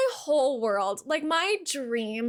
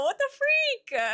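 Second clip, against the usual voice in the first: much higher startled exclamation; high surprised squeak; 12 semitones higher, slower than usual. Real, not acted. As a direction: yelp it in surprise. Surprised reaction to the game.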